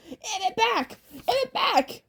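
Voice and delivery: high, frantic crone cry; shrill witch demanding; hag yelling. Acted. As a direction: wail for your stolen tooth.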